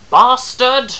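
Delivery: loud insult flung back; a sharp shouted retort; crying back. Acted. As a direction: yell it straight back at him. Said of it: "I cried back".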